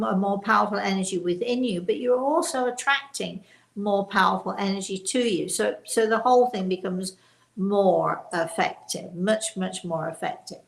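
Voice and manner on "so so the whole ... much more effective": ordinary talk, nothing marked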